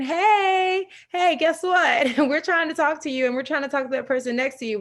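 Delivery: high, loud playful call that speeds up at the end; body calling out. Retold or imitated. Her body calling to her, a high, loud, laughing call. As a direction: hail her like an eager inner voice. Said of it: "my body is just saying".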